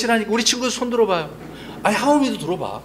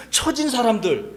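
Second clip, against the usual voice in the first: ordinary talk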